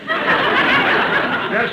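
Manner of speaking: very high yelp of laughter; laughing yes. Acted. To her husband's riddle, a laughing shriek. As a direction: squeal the word through a laugh.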